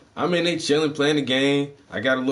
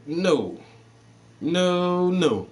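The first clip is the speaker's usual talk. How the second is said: ordinary talk, nothing marked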